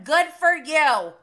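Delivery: loud, slow, high mock congratulation; sarcastic congratulation. Real, not acted. Host answering Raquel's trip boast, sarcastic congratulation. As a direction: draw out the sarcasm loudly.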